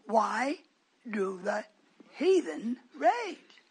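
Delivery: "Why, do the, heathen rage" broken by pauses, RAGE rising; drawn-out emphasis. Shown as a demonstration. Preacher quizzes the congregation, slow emphatic "heathen RAGE". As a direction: space out "Why do the heathen rage", stretching the last words. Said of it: "I've been putting a little more emphasis on it".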